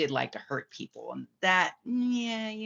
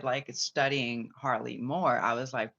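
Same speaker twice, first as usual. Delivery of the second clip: normal talking voice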